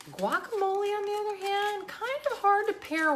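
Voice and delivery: high sing-song, slowing. Real, not acted. Vlogger teasing guacamole pairing, high sing-song. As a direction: lilt it up playfully.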